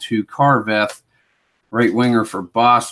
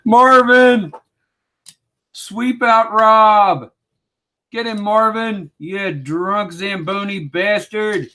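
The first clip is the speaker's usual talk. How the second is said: much higher, slow, squeaky egging-on; cartoonish high-pitched heckler; squeaky cheering voice; 10 semitones higher, slower than usual. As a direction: sic the Zamboni driver on Rob in a silly high voice. Cheering on the Zamboni driver.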